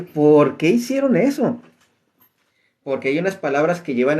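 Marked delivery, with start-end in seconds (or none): 0.0-1.6 higher, slow, bewildered why; retold surprise. Teacher recalling reading the altered verses, surprised. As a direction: sound baffled at what they did. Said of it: "sí me quedé sorprendido y dije".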